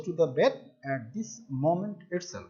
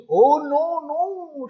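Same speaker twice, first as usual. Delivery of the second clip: high-pitched storyteller's cry of protest; mild mock scream; 7 semitones higher, much slower than usual. A narrator's reading. Child about to be beaten, mild mock scream. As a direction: plead in a raised pitch, not a full scream. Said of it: "she screamed".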